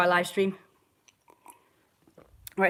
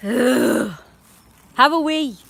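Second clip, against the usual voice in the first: loud, high, exasperated order; real exasperated shout at the dog; yelling at the dog; 5 semitones higher, 9 dB louder, much slower than usual. Real, not acted. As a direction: make the dog hurry up and wee. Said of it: "I'm yelling at the dog".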